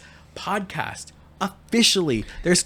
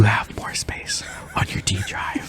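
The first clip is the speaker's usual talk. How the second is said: whispering; 5 semitones lower, 5 dB quieter, slower than usual. Real, not acted. Keeps whispering to partner.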